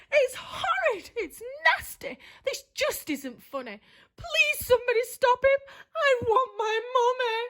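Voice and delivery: high, crying wail; wailing witch. Acted. Witch horrified by the fairy party, crying out. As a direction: whimper like a scared child. Witch terrified by cute decorations.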